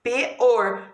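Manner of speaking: "P-or" broken into P, then or; split syllable. Shown as a demonstration. Teacher shows pupils por cannot be divided, "P-or" split in two. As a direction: say "P-or" in two separated pieces. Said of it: "vai ficar P tracinho or".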